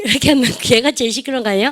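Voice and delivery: usual speaking voice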